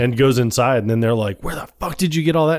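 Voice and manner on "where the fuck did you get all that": fast, higher stunned outburst; mock astonished. Friends stunned by his pitch, mock astonished. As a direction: blurt it out in amazed disbelief.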